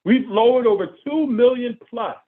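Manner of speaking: normal talking voice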